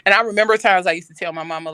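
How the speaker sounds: ordinary talk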